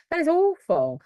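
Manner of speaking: high, shocked "awful"; shocked sympathy. Real, not acted. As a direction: gasp it out in dismay. Reacting to a sad viewer comment.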